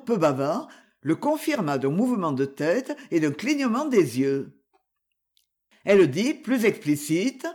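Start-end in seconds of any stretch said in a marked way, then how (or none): none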